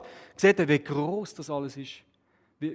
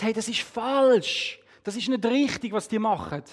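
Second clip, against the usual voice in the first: indignant protest; 5 semitones higher than usual. A narrator's reading. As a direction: object firmly, pitched up. Inward protest at injustice. (empörter, höherer Einspruch)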